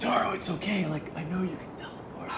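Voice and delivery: hushed, unvoiced reassurance; soft conspiratorial whisper. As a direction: lean in and whisper it kindly.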